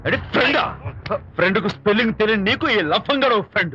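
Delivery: high-pitched heated retort; scornful anger. Acted. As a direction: throw the word back with angry contempt. Angry man scorns the friend.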